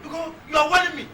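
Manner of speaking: high, loud, affronted; indignant retort. Acted. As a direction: fling the warning back at them.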